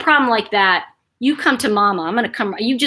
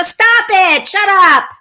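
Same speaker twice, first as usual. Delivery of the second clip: loud, high mock yell; imitated grandmother yelling shut up; grandmother with a mouth; 9 semitones higher, 8 dB louder, much slower than usual. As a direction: scold like a fed-up grandma. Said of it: "my grandmother would go".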